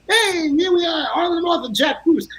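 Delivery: high, bright announcer flourish; mock showman intro. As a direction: introduce yourselves like nothing happened. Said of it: "so we hit the spotlights".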